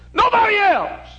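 nobody else cried high and loud; emphatic. Acted. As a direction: hammer home nobody else. Preacher's emphatic climax.

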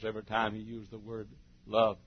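ordinary talk

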